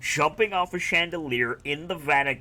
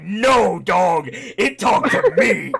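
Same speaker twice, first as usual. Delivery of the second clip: mock insistent shout; 8 dB louder, slower than usual. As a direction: shout it like an exasperated imitation. Acting Desmond's insistent retort.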